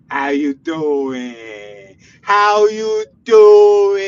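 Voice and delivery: slow drawn-out how you doing; wiseguy greeting. Pretend. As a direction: lean on it like a mobster. Mock tough-guy greeting.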